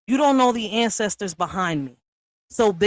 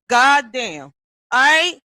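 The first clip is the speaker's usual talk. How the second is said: normal talking voice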